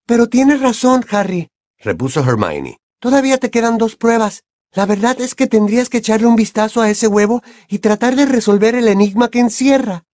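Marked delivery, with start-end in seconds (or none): 0.1-1.5 put-on much higher girl's voice, insistent; earnest girl friend. A narrator's reading. 3.0-10.0 put-on much higher girl's voice, insistent; earnest girl friend. A narrator's reading.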